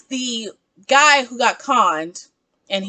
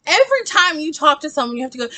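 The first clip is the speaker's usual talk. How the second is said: ordinary talk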